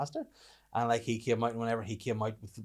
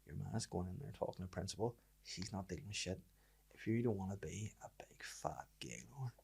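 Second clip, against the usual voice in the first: low, much softer mock-confidential voice; dad's private word; 4 semitones lower, 12 dB quieter than usual. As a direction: imitate a dad's hushed aside, dropping into a whisper. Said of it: "got me to the side".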